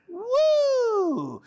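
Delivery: high long cheer; excited woo. Shown as a demonstration. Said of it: "you're gonna say".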